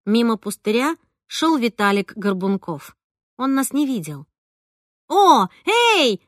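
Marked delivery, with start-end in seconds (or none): 5.1-6.2 happy call across a wasteland; delighted hail to a passing friend; glad call. Acted. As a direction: wave and call out gladly. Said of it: "обрадовалась я и замахала руками".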